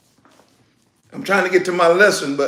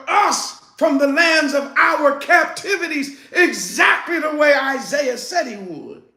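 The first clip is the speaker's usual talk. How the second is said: raised-pitch, forceful sermon delivery; preach; 8 semitones higher, 4 dB louder than usual. Real, not acted. Preacher promising the congregation victory in the end, forceful preaching.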